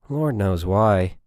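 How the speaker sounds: ordinary delivery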